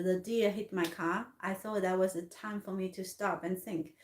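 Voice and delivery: ordinary talk, nothing marked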